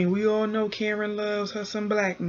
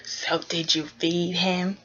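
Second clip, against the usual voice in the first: slow breathy whisper; Big Ange impression; slower than usual. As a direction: whisper it slowly like a nosy friend. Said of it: "How does Big Ange sound?".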